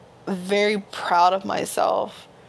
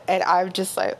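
normal talking voice

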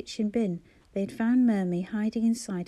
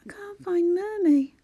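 put-on high, trembling little boy about to cry; child's voice breaking with distress; wavering, tearful; 10 semitones higher, 5 dB louder than usual. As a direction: let the voice crumple into tears. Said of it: "his voice wavering and his face crumpling".

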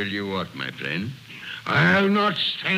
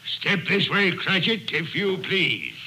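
ordinary delivery

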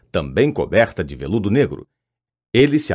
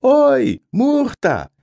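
much higher and louder yell across the room; bellowing call; 5 dB louder, much slower than usual. A narrator's reading. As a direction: shout her name to summon her. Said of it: "Ele tomou fôlego e berrou". (berro alto e agudo chamando a Murta)

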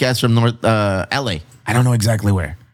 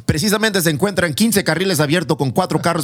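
rapid Spanish traffic report voice; Spanish radio announcer; 6 semitones higher than usual. Pretend. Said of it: "I feel like I'm home".

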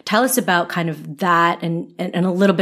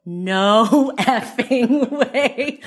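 laughing disbelief; 6 semitones higher, much slower than usual. Retold or imitated. Retells shock at the coincidence.

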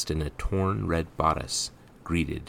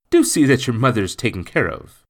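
loud, raised, caring instruction; kindly gentleman; 7 semitones higher, 10 dB louder than usual. A narrator's reading. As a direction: urge the child earnestly as you part. Gentleman parts from the boy.

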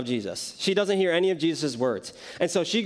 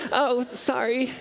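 slow, high, mock apology with a laugh; a drawn-out, snickering fake apology; sarcastic laughing sorry; 7 semitones higher, slower than usual. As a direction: laugh it off knowing it wasn't an accident. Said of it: "She's like".

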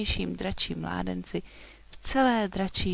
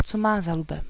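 plain reading voice

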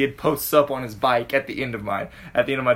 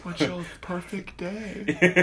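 lightly, softly; 4 semitones higher, 6 dB quieter, much slower than usual. Retold or imitated. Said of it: "just so lightly".

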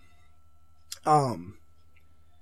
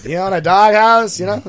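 callback in a higher, louder comedian voice; Norm Macdonald impression; 5 semitones higher, 9 dB louder than usual. Host repeats the punchline for laughing friends, higher and louder. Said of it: "I love my Norm impression".

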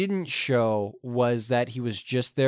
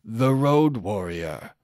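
mock epic narration; 4 semitones lower than usual. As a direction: narrate like the film's old narrator looking back. Parody Road Warrior closing narration.

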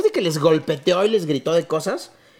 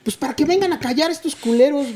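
voz más aguda del vecino quejándose; el vecino exigiendo silencio, imitado; imitando al vecino; 8 semitones higher than usual. Retold or imitated. Vecino pidiendo callar la fiesta. (el vecino enojado, imitado con voz aguda)

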